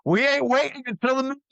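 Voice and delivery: high-pitched bossy stomach voice; stomach talking. Acted. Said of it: "my stomach's like".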